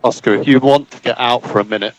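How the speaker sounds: ordinary delivery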